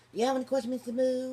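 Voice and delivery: high-pitched mock Louisiana accent; put-on Louisiana twang, pitched high; Louisiana accent impression. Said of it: "supposed to be a Louisiana accent".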